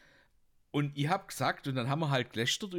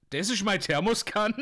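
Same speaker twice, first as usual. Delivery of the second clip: louder and higher, offended saleswoman; indignant saleswoman; 6 semitones higher, 6 dB louder than usual. Acted. Saleswoman telling off the customer, indignant and louder. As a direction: bristle like a clerk defending her flask.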